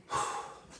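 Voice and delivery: slow audible exhale; deep breath out. Real, not acted. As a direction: breathe out deeply for the doctor. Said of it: "whenever you're ready".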